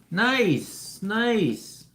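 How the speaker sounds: high, falling happy exclamations; excited approval. Real, not acted. Streamer reacting to a viewer's comment, delighted.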